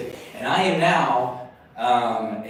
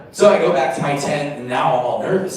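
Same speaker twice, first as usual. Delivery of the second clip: ordinary talk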